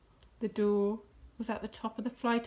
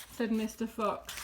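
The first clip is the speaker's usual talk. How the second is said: usual speaking voice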